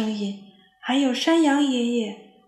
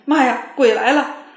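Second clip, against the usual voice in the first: loud terrified scream; yelling; 3 semitones higher, 9 dB louder than usual. A narrator's reading. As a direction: shout in panic at the ghost. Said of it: "沃尔夫大叫一声". (大叫)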